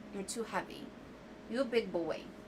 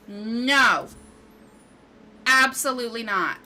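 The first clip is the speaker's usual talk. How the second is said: angry outburst; 3 semitones higher, 9 dB louder than usual. Real, not acted. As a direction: yell it at the screen, fed up. Game glitch, angry outburst.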